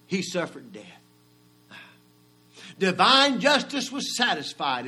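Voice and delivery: high, loud preaching cry; preaching at full force. Acted. Preacher driving home the price of sin, full force. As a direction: ring it out to the congregation.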